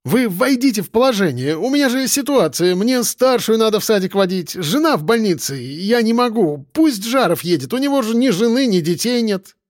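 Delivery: high-pitched, tearful pleading; plaintive, hammed-up wail, pitched well up; whiny wailing. A narrator's reading. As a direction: whine your excuses to the boss. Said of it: "плаксиво заголосил он".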